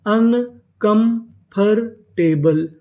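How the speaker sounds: Uncomfortable slowly split as अन, कम, फर, टे, बल; syllable by syllable. Shown as a demonstration. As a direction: sound out uncomfortable part by part, pausing after each. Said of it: "तो पूरी meaning". (Uncomfortable को धीरे-धीरे हिस्सों में तोड़कर)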